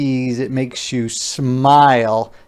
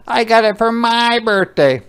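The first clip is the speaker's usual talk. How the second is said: high, slightly loud insistence; a girl yelling her claim; high insistent girl; yelling Ruthie; 12 semitones higher, 7 dB louder than usual. Acted. Ruthie voiced.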